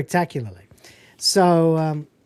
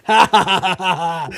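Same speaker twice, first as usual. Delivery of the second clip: forced laugh. Retold or imitated. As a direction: laugh along through embarrassment. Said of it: "and I was like".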